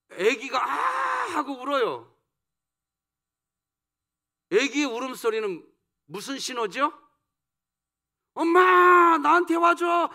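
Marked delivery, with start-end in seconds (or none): 0.6-1.1 mock baby wail. Imitating a baby's cry. 8.3-10.1 put-on high, loud childlike plea; baby calling mom. Preacher voicing a baby calling mom, loud childlike plea.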